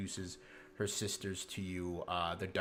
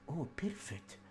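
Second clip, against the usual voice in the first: cooing at a mouse; 5 semitones higher, 5 dB quieter than usual. Acted. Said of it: "and she goes".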